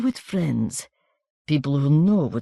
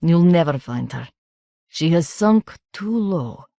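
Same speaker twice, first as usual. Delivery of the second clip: coarse country voice; 2 semitones higher than usual. A narrator's reading. Baroness consoling the stranger in a coarse country accent. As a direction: relish the woman's downfall, coarsely.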